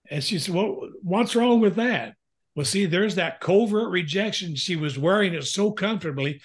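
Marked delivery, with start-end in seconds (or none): 0.5-2.1 puzzled wife. Retold or imitated. Retelling how his wife answered him, puzzled and higher. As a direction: lift the pitch and ask it innocently, as the wife.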